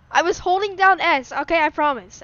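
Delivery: loud, high-pitched excuse; loud protesting. Real, not acted. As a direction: protest your innocence loudly. Excuses after crashing into friend's car.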